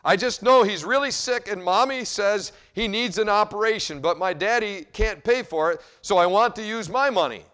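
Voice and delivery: put-on a girl pleading for her brother; tearful little girl. Little girl explaining to the doctor, high and tearful.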